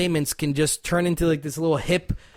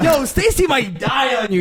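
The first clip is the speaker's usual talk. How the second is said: raised playful yell; mock shout; 6 semitones higher than usual. Shouted joke.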